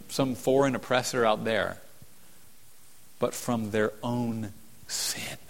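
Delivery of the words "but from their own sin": breathy whisper on their own sin. Acted. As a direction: drop to a slow whisper. Hushed climax of sermon point.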